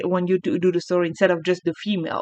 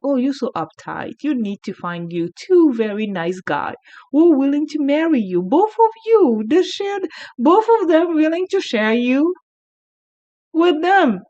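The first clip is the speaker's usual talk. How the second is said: lightly voiced, higher and teasing; teasing friend; 6 semitones higher than usual. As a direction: tease her for being uptight. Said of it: "It's like".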